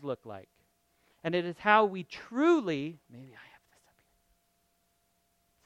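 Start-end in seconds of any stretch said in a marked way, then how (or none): 3.1-5.7 breathy whisper to himself; hushed aside. Real, not acted. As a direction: mutter it under your breath while looking. Aside while searching his slides.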